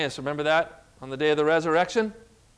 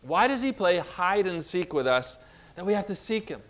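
ordinary talk, nothing marked

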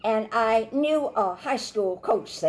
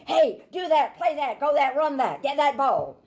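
coach shouting; 6 semitones higher than usual. Retold or imitated. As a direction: bark orders like an excited coach on the sideline. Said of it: "and he's shouting at the players".

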